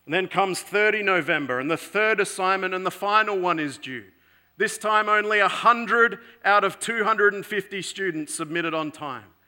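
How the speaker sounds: ordinary delivery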